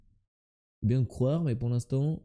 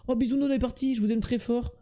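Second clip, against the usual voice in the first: sing-song goodbye; 8 semitones higher than usual. Real, not acted. High sing-song goodbye to chat.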